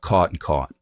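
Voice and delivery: both words of "caught and caught" rounded alike; Boston merged vowel in "caught and caught". Shown as a demonstration. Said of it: "the sound is rounded".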